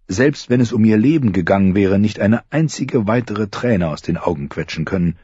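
ordinary talk, nothing marked

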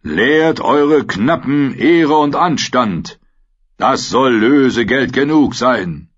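slow, commanding knight through a helm; booming knight voice, slow and grave; dark lord. A narrator's reading. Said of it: "mit dröhnender Stimme".

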